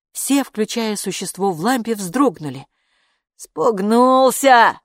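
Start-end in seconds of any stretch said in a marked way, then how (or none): 3.3-4.8 loud, high, drawn-out cry; shouted exclamation. A narrator's reading. Kaapo sees the creature scared off, loud stretched cry. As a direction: call it out slowly with raised voice. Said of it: "крикнул Капо".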